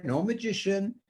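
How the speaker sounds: ordinary delivery, nothing marked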